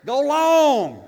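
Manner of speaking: sudden high, loud call across the room; playful shout. Pretend. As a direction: yell it like tossing a football downfield. Mock throwing rolls to disciples.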